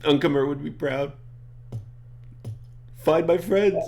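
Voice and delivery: high, emotional pleading; a little upset. Acted. Elmo begs Kane to find his friends, a little upset. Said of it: "he's getting a little upset".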